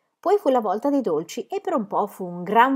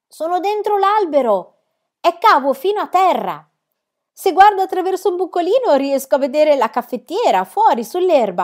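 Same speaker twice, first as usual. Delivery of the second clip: high, loud calling from down below; voice from the depths; 9 semitones higher, 8 dB louder than usual. A narrator's reading. Girl inside hollow tree calls up to friends, loud. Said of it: "Sembrava scaturire dall'oltretomba".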